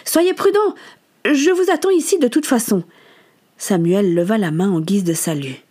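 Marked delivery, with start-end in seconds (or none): 0.1-2.8 high-pitched call after departing youngsters; raised, carrying voice. Acted. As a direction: call after them from a distance. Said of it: "Alice West interpella les jeunes gens".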